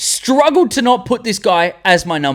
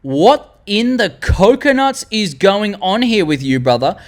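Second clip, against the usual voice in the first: high, slow, exaggerated exasperation; mock disbelief; 6 semitones higher than usual. Viewer challenges Jeremy's pick, mock disbelief. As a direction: draw it out high like a baffled viewer. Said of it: "I know you're thinking, you're like".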